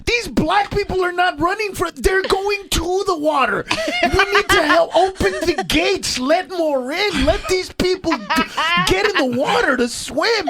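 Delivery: high-pitched impassioned rant; worked-up, high incredulous comic tirade; mock outrage. Comic rant over pool incident.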